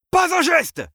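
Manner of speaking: high, very loud order to freeze; sudden full-force shout; shouted command. A narrator's reading. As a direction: yell it like an armed lawman. Armed director halts escaping prisoners.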